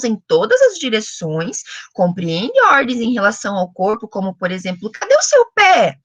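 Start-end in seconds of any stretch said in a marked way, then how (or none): 4.9-6.0 put-on high, loud question to a baby; pergunta para bebê. Shown as a demonstration. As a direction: ask a baby in a high sing-song. Models question asked to baby. (pergunta aguda, como para bebê)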